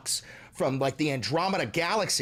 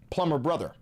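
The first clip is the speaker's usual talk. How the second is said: ordinary delivery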